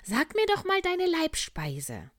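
a kindly little bear voice, high and loud. Acted. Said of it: "sagte der kleine Bär".

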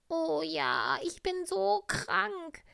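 very high, loud and slow, a pitiful poorly tiger; a hammed-up sick tiger voice, high and drawn out; sick little tiger. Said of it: "rief der kleine Tiger".

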